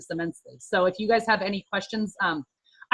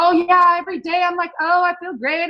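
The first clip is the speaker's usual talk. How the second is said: mock gushing; 9 semitones higher, 5 dB louder than usual. Mocks overly upbeat shake users.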